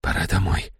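soft, resigned, barely voiced; whisper. A narrator's reading. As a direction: whisper it, close to tears. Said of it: "прошептал я". (шёпот сквозь слёзы)

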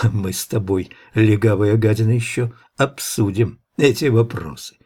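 ordinary delivery

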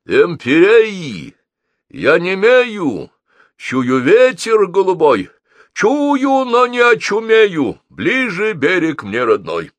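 loud, high, grandly drawn-out declamation; slow, soaring bombastic verse; poem recital. A narrator's reading. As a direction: declaim like a pompous poet, stretching every vowel. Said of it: "прочёл начало новой поэмы".

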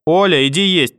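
loud call from another room; calling from the kitchen. Acted. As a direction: shout it across the flat. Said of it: "крикнула из кухни бабушка". (громкий оклик из кухни)

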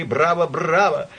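loud, drawn-out cheering; shouted bravo. A narrator's reading. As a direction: cheer like an applauding crowd. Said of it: "кричали".

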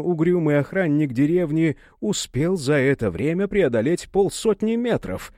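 plain reading voice

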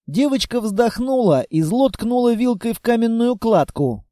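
ordinary delivery, nothing marked

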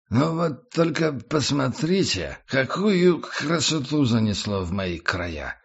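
put-on slow, slurred drunk voice; drunk slurring. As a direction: slur it like a tipsy tramp. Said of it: "Заплетающимся языком произнёс незнакомец".

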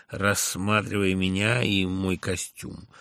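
ordinary delivery, nothing marked

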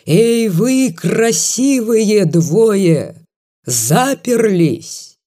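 put-on high, drawn-out drunken yell; drunken shout. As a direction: bellow it slowly, eyes bulging. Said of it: "вытаращил глаза и закричал".

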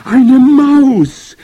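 very high, very loud shriek; piercing witch squeal; screeching. A narrator's reading. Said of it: "kreischten die Hexen".

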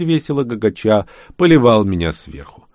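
ordinary delivery, nothing marked

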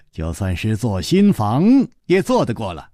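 usual speaking voice

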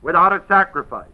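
"Without" pressed, then quick; stress on without. Shown as a demonstration. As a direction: hit "Without" and rush the rest. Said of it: "That's how it's said".

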